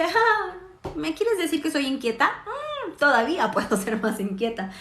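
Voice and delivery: high, sassy mock-challenge, rising sharply; defiant child. Daughter defiantly answers the teacher's complaint, high sassy voice. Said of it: "Mi Silvanita escucha eso y dice".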